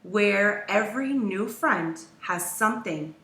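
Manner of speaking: plain reading voice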